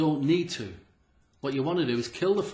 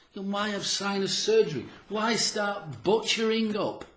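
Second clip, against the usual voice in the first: indignant rhetorical; 7 semitones higher, 5 dB louder than usual. Real, not acted. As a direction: demand it with disbelief.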